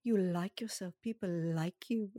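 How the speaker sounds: put-on slow, accented affirmation voice; possibly South African accented guide voice; hypnotist's foreign accent. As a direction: imitate an accented hypnotist on tape. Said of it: "I don't know what that accent is".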